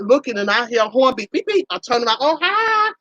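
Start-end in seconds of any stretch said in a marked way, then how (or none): 1.3-1.7 imitated horn beep. 2.2-2.9 surprised high hi. Retold or imitated. Surprised greeting to a driver.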